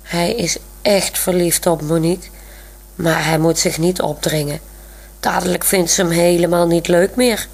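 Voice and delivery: ordinary delivery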